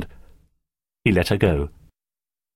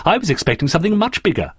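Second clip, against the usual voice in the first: fast, high reaction; exclaiming; 8 semitones higher, faster than usual. A narrator's reading. Terry reacts to the small device, exclaiming. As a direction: blurt it out in surprise. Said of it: "exclaimed Terry".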